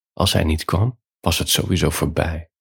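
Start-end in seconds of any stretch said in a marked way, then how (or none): none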